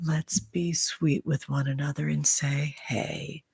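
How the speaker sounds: hushed breathy whisper to the viewers. Real, not acted. Streamer whispering a goodbye to her viewers, hushed whisper. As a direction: drop to a soft secret whisper.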